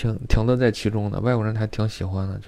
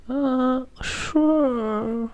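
very high, soft coy "sure"; high, coy falsetto; coy girl voice; 3 dB quieter, much slower than usual. Retold or imitated. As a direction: purr it like a pampered girl. Mimicking the coy tenant.

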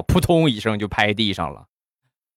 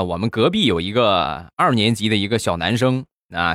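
usual speaking voice

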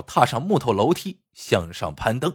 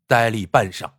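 usual speaking voice

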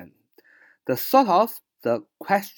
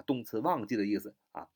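usual speaking voice

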